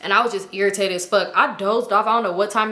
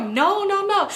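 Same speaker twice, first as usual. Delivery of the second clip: much higher, rapid protest; much higher flustered denial; frantic denial; 10 semitones higher, slower than usual. Retold or imitated. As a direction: panicky no.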